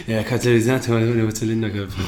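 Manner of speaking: low, mumbled and laughing, the quirky repairman; lightly voiced mumbling Herr Sommer; mumbled repairman impression. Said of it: "Also so war der immer". (leise gemurmelt wie der schrullige Herr Sommer)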